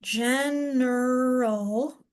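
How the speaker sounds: General stretched out very slowly; very slow blend. Shown as a demonstration. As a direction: draw out General as you try it. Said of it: "So that would be".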